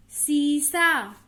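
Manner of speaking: "सीसा" with a long, slow first ee; long vowel. Shown as a demonstration. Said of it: "ई देर तक बोला हमने".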